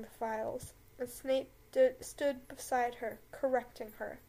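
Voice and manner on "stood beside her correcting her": ordinary delivery, nothing marked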